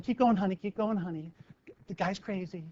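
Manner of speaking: a mother murmuring to keep walking; hushed parent warning. Retold or imitated. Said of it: "families walking by going".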